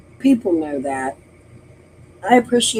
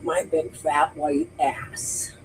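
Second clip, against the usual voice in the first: slow, breathy whispered insult, much softer; 5 semitones lower, 9 dB quieter, slower than usual. Real, not acted. Streamer whispers the insult to her haters, slowly. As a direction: hiss the insult slowly under your breath.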